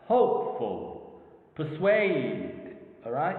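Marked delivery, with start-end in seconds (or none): 0.1-1.2 "Hopeful" slow, HOPE clearer than "ful"; stress on first syllable. Shown as a demonstration. Teacher explaining pressure on a certain syllable, "Hopeful" stressed on HOPE. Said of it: "it's clear than the rest". 1.5-2.8 "persuade" with a long, clear SUADE; stress on second syllable. Shown as a demonstration. Teacher asking focus on how they are pronounced, "persuade" stressed on SUADE. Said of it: "a kind of stress and clearness".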